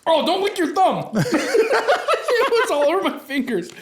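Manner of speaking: high, slow laughing words. Real, not acted. Laughing, sour fingers.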